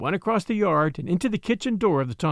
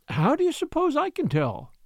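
high-pitched motherly exasperation; acted impatience with a pestering child; exasperated; 10 semitones higher than usual. A narrator's reading. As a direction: toss the question back, pitched right up. Mother, pestered, answers daughter's question.

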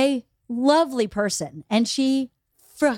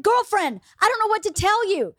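exasperated mom; 7 semitones higher than usual. Retold or imitated. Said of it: "And I was like".

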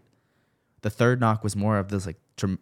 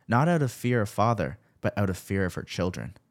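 usual speaking voice

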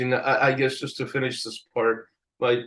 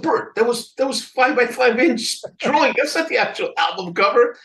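raised, insistent retold protest; animated retort; 10 semitones higher, 5 dB louder than usual. To art director Bert about the cover, a raised insistent protest. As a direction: push back at the boss, voice up high.

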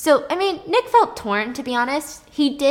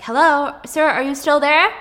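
phone operator; 6 semitones higher, 8 dB louder than usual. Retold or imitated. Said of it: "The nine one one operator's like".

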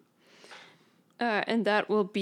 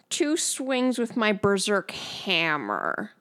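ordinary delivery

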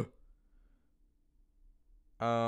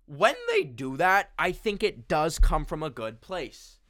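ordinary talk, nothing marked

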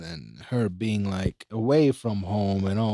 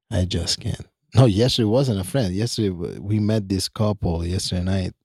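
ordinary talk, nothing marked

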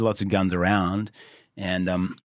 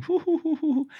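very high-pitched "hoo-hoo" tittering; imitated giggling hoots. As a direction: mimic boys hooting behind their hands. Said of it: "just going".

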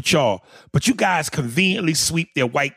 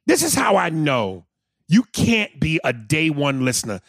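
ordinary delivery

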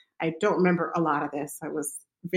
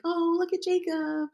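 distracting sweet voice; 11 semitones higher than usual. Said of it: "try to distract me".

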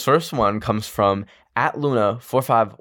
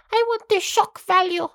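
squealing hype. Pretend. Podcaster squealing with excitement about listener stories. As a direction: squeal it much higher. Builds up to shock value.

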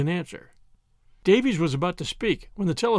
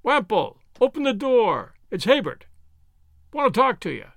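loud shout through the door from the street; calling out; 5 semitones higher, 4 dB louder than usual. A narrator's reading. Man outside calls Wemple to open the door, loud shout. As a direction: call up to the house, loud and urgent. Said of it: "a man's voice calling".

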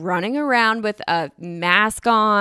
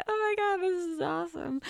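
high, hushed squeak of a reaction; mock small-voiced awe; 10 semitones higher, 10 dB quieter than usual. Nervous friend answers about free tickets, in a tiny high mock voice. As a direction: say it tiny and high, imitating yourself.

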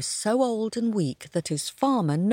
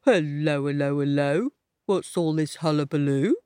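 donkey voice; 5 semitones lower than usual. Acted. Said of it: "asked Donkey".

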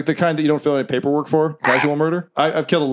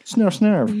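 dog snort; 5 semitones higher than usual. Acted. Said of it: "turns to you and goes".

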